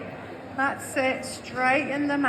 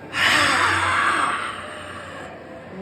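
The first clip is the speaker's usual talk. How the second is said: long whispered roaring exhale; breathy dragon roar. Real, not acted. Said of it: "Roar it out".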